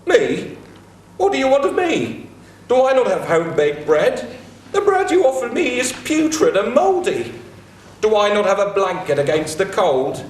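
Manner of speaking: high, incredulous mock protest; indignant gardener. Gilgamesh mimics Ishulanu rejecting Ishtar, high and indignant. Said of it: "Ishulanu replied".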